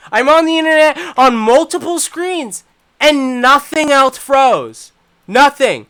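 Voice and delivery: loud, high, slowed furious rant; real livid ranting. Real, not acted. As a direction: rant at the screen, boiling over. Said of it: "I am fucking livid".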